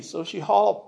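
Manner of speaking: plain reading voice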